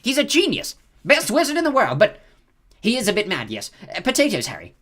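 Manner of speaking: Percy airily praising Dumbledore; airy Percy. A narrator's reading. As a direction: brush it off lightly.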